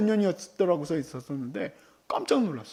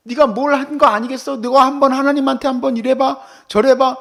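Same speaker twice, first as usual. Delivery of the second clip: higher, louder, scolding friend's voice; lecturing friends; 5 semitones higher, 6 dB louder than usual. Retold or imitated. Job's friends scolding him, higher and louder. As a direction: wag a finger at Job as his know-it-all friend. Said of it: "친구들이 와서 뭐라 그래요?".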